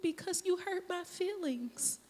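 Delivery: put-on high, soft voice of a wounded little girl; hurt crying child. Daughter tells mom why she cried, hurt child voice. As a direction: imitate a small child with hurt feelings. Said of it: "Why are you crying?".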